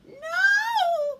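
high, loud wailing shout; witch's frightened cry. A narrator's reading. Witch flees the hungry dragon, crying out high. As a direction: cry out in storybook terror. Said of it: "cried the witch".

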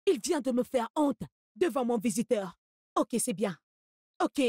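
ordinary delivery

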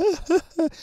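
high, hammed-up mock sobs; pretend crying. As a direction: fake a short burst of high-pitched crying. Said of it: "simula que llora". (llanto fingido y agudo)

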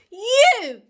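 high, very loud accusing shout; crying out. Acted. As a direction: shout it at the culprit. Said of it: "he cried".

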